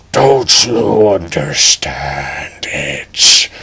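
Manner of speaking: very slow, low dragon rumble. Acted. The dragon questioning Edge, slow low rumble. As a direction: drag it out like a brooding beast.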